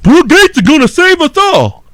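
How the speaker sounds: high, silly voice of gullible fans; mocking listeners. Host mocks gullible listeners, in a high silly voice. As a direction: parody them as naive fools. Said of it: "half of you are like".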